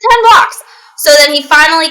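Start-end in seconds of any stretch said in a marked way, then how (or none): 0.1-0.6 much higher and much louder, disbelieving; exasperated. Real, not acted. Telling her co-host the driver was ten blocks away, outraged. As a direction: blurt it out in outrage. Repeating the shocking distance.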